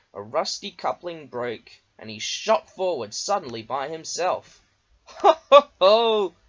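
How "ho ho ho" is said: high, loud hearty chuckle; chuckling. A narrator's reading. Duck chuckling as Diesel shoots forward. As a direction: laugh at Diesel's mishap. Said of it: "chuckled Duck".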